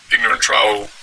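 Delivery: usual speaking voice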